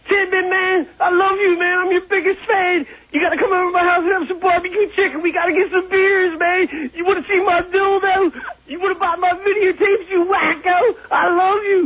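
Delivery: high, fast, over-eager fan gush; gushing superfan. Retold or imitated. Telling the actor he loves him, as a high gushing fan. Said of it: "and I'm like".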